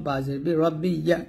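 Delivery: ordinary delivery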